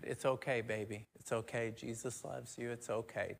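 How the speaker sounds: a mother's hushed, soft comfort; soothing mom. Retold or imitated. Mom soothing her grown son, soft and hushed. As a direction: coo it softly like a mom patting a hand. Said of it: "you know how moms are".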